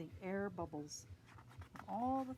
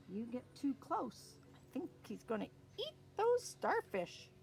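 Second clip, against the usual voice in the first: usual speaking voice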